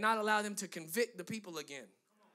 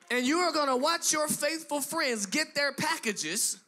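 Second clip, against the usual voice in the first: louder, rising preacher's build, hammering each line; preaching at full force; 2 semitones higher, 5 dB louder than usual. Acted. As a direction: drive it home to the congregation like a revival preacher.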